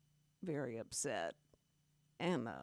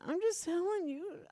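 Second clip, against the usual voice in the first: much higher, playful put-on voice; mock high sing-song; 11 semitones higher than usual. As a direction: toss it back in a squeaky teasing tone. Teasing reply to friend.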